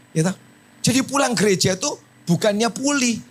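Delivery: normal talking voice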